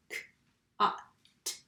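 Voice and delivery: "k, a, t" as three isolated sounds with pauses; sounded out. Shown as a demonstration. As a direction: say each sound of "k, a, t" separately, short vowel. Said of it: "I would sound out first".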